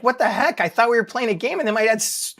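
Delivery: imitating a baffled child, high-pitched; confused kid. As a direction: voice a little kid's puzzled protest. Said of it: "He was probably just confused".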